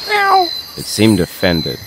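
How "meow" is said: lower cat meow. Retold or imitated. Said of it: "when I said hello".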